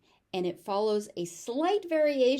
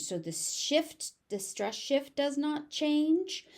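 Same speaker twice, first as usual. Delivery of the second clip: ordinary delivery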